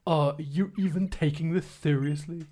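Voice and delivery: lightly voiced sulky teen boy; a hint of a moody teenager scolding; angsty teen boy Gladion. Rival challenging the player, lightly voiced sulky teen. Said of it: "it's angsty teen boy Gladion".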